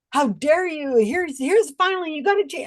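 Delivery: a hint of a snide troll; mean tagger. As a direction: ham up a nasty online attacker. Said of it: "I mean, just very mean".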